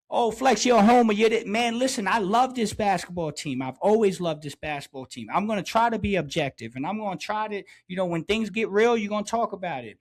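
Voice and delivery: normal talking voice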